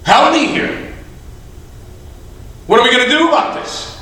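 acted cry for help, not shouted; pleading appeal. Satan appeals to God for help.

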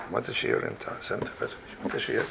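very soft, half-whispered pondering; muttering to himself. Real, not acted. Teacher puzzling over the measure for same town, muttering to himself. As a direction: murmur the question privately, barely voiced.

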